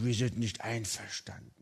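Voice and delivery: quiet, grim murmur; grim relatives. Retold or imitated. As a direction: mutter it like a disapproving clan. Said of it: "so nach dem Motto".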